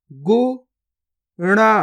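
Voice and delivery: गुफा drawn out very slowly, each sound held long; slow stretched reading. Shown as a demonstration. Teacher showing pupils each sound, drawn out very slowly. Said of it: "पहले तो आपको शब्द को गौर से सुनना है".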